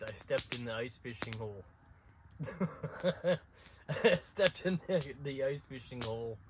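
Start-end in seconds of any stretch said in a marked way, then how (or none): 2.2-3.8 laughs. Real, not acted. Laughing at own mishap. 3.9-6.4 laughing. Real, not acted. Laughing retelling the mishap.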